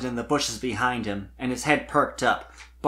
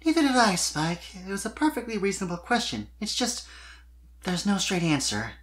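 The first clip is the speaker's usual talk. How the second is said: reassuring; 7 semitones higher than usual. A narrator's reading. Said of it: "she assured him".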